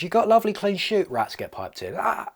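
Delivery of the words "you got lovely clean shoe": Rat Piper. Acted. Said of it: "Shining the shoes".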